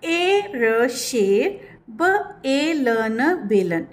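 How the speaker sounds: very slow sounding out; slow spelling out. Shown as a demonstration. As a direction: sound each letter, then blend the word. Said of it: "जैसे".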